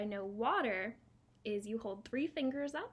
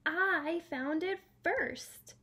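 slow, loud bear blurt; gruff bear outburst; 6 semitones higher, 6 dB louder, much slower than usual. Acted. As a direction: burst out like a big bear. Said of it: "said the bear with a burst".

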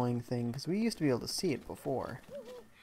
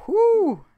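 loud whew; 15 dB louder than usual. Real, not acted. Relief after a risky climb.

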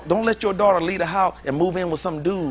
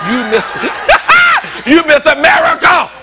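you Miss America shouted high through laughter; laughing shout; 8 semitones higher, 7 dB louder than usual. Real, not acted. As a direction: cheer her on, half laughing, half yelling. Hyping daughter, laughing shout.

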